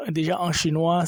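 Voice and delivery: usual speaking voice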